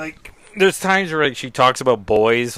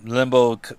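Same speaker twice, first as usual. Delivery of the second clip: ordinary delivery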